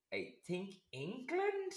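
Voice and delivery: high, slow, hesitant guess; unsure answer. Retold or imitated. Retelling her answer about the fiancé's origin, high and unsure. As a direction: draw it out with a questioning lift.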